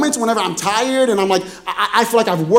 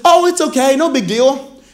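imitating a sweet wife, higher pitch; high, easygoing mock-wife reassurance; 3 semitones higher than usual. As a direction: brush it off sweetly, voice lifted high. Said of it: "she was so sweet about it".

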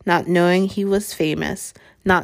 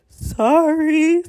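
much higher, tearful sorry; a big man's hammed-up sob; sobbing; 10 semitones higher, 3 dB louder than usual. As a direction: blurt it through tears. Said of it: "sobbed Hagrid".

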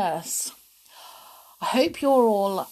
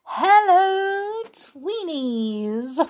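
sing-song greeting; 7 semitones higher, 4 dB louder, much slower than usual. Pretend. Cheery greeting to child listeners.

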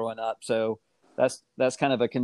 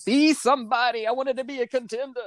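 high, playful dramatic delivery; mock movie line; 11 semitones higher than usual. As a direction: ham up the famous contender line. Quoting a film line playfully.

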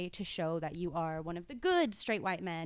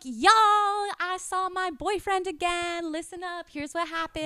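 broadcast it like a higher-pitched loudmouth; loud-mouthed announcing; 8 semitones higher, 8 dB louder than usual. Retold or imitated. Worker back from lunch gushing to coworkers, loud-mouthed. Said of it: "I've got such a loud mouth".

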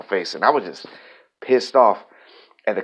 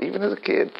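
real voice going up with feeling; voice jumping up; 11 semitones higher, 3 dB quieter, faster than usual. Real, not acted. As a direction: pitch leaps up much higher, emotion rising. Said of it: "I heard my voice just go up".

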